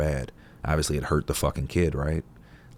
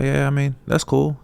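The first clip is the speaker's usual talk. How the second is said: a touch higher, offhand shrug; mild farmer voice; 10 semitones higher, 4 dB louder than usual. Retold or imitated. Farmer shrugging off the neighbor's praise, lightly higher. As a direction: brush it off lightly.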